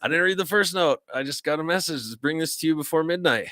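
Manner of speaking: high-pitched defensive protest; flustered messenger. Acted. Messenger explaining he only delivers the note, flustered. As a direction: voice the put-upon messenger, pitched well up.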